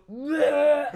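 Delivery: loud, high mock heave; imitated retching. Friend sick out the truck window, loud imitated retch. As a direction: fake throwing up out the window. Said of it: "stuff that he would throw up about".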